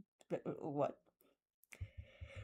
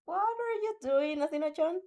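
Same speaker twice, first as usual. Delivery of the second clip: puzzled; 9 semitones higher, 3 dB louder than usual. Acted. Denki puzzled at staring Athena.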